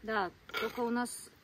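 ordinary delivery

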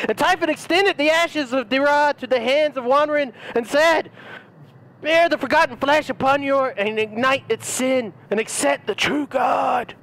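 mock fire-and-brimstone sermon; fervent preacher reading. Reads the book in preacher voice.

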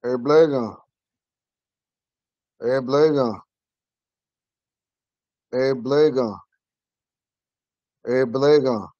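Hey, Blega, then Eblega said with a clear falling end; Ponca word. Shown as a demonstration. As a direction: model the Ponca word for the class. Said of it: "I think or I thought".

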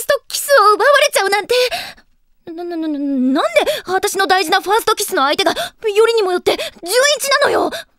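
loud, high, stammering into a wail; panic, climbing to a high cry; flustered outburst. Acted. Girl lamenting her stolen first kiss, frantic outburst.

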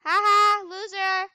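loud, high teasing jeer; playful loud jeer at the dead player; mock taunt. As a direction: gloat at them in jest. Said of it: "I'm joking".

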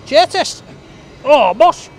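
loud, high and pleased; excited. Real, not acted. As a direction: plop down and cheer the seat. Sitting down, testing the chair.

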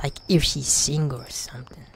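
hushed unvoiced aside; whisper. Real, not acted. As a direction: whisper so she can't hear. Whispering to friend.

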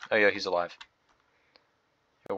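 ordinary delivery

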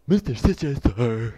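loud, goofy underling; stupid minion voice. Acted. Dim henchman addressing the boss, loud stupid voice. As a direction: announce it loudly as a dopey minion.

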